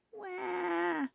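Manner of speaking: put-on very high, long drawn baby wail. A narrator's reading. Baby ghost on the step wants feeding, high wail. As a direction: cry like a newborn. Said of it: "yelled the baby ghost".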